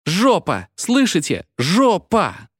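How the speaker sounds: high, loud, last-strength yell; exhausted child shouting a rude word; desperate child's scream. A narrator's reading. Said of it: "из последних сил закричал".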